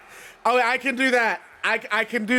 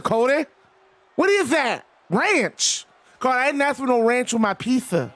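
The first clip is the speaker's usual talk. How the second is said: higher-pitched wrestling-legend impression, indignant then pleased; Dusty Rhodes; 5 semitones higher than usual. Wrestler scolds Cody over ranch on pizza, in impression. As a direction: play an old wrestler fussing over his food. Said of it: "I will do a Dusty Rhodes voice".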